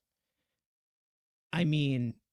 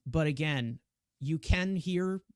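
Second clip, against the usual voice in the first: ordinary talk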